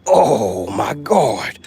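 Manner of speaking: normal talking voice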